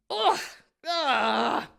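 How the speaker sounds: loud irritated ugh; annoyed grunts. A narrator's reading. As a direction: grunt from a knock on the head. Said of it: "he grunts".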